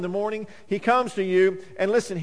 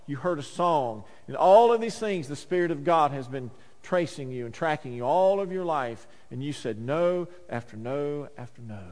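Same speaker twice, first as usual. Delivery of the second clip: normal talking voice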